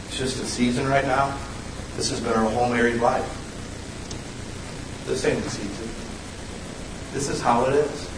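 soft, low spouse's correction; hushed, resigned married-life reply; weary spouse. Retold or imitated. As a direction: quietly set the record straight as the spouse. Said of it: "talk to your wife or your spouse".

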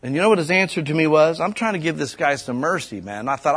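normal talking voice

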